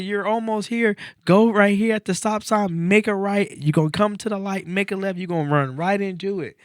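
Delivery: high, loud, fast call-back of directions; shouted directions. Retold or imitated. Joggers call directions to the lost driver, high and loud. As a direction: yell the way back to him.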